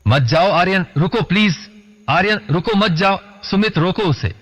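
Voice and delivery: high-pitched, urgent fearful plea; frightened pleading. A narrator's reading. Khushboo stopping Aryan from nearing Rancho, frightened pleading. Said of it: "डरी और दबी आवाज में".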